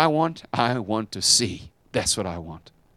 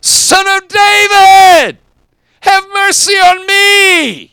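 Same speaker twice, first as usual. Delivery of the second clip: drawn-out cry, much higher and louder, slow; long wailing plea to be heard over a crowd; desperate shout; 16 dB louder, much slower than usual. Retold or imitated. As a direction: beg at the top of your lungs from the roadside. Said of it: "he started yelling out".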